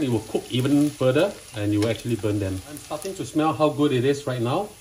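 ordinary talk

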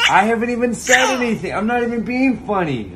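amused, giggly protest; laughing. Real, not acted.

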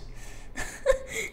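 breathless high-pitched wheeze of a laugh; cracking up at the fake apology; high wheezing laugh. Real, not acted.

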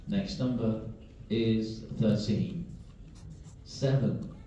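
steady sing-song number calling; bingo caller. Acted. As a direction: call each number out to the hall.

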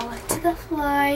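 ordinary delivery

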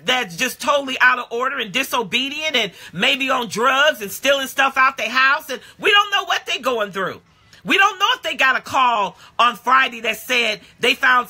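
insistent, building pulpit refrain; impassioned preaching. Real, not acted. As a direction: press the point home, rising to a high peak. Preaches at full force.

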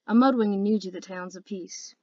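usual speaking voice

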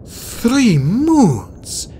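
high, drawn-out breath of wonder; awed exclamation; astonished. A narrator's reading. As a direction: marvel at a startling sight. Starflight amazed by what he sees.

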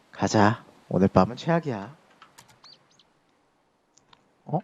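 ordinary talk, nothing marked

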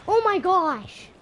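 loud, drawn-out exclamation; startled outcry. Real, not acted. Player in a tense fight, startled outcry. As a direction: cry out slowly and loudly in shock.

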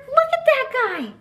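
high excited gush; gushing delight. Acted. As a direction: squeal over the cute fish. Reading picture book to kids.

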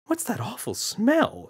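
a prim mother wrinkling her nose; disgusted mother. Acted. Mother smelling something.

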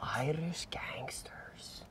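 hushed, secretive whisper. Real, not acted. Landlord confiding to the new renter, whispering.

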